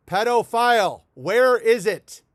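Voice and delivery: loud, slow, drawn-out yelling demand; mock shout. Comedian yelling at the Walgreens clerk in his bit, mock shout. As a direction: bellow the punchline at the clerk.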